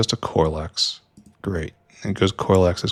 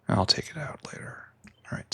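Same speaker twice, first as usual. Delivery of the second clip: higher whispered aside; whispering; 7 semitones higher, 11 dB quieter, faster than usual. Real, not acted. Aside to himself.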